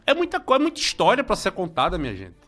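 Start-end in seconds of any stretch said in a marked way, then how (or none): none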